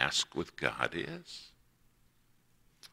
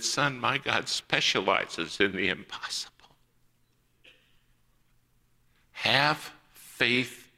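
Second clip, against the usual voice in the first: a hint of the mother, higher and earnest; mom's voice of faith; 5 semitones higher, slower than usual. Mother reassuring her doubting son with a touch of fervor. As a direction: let her firm belief ring out.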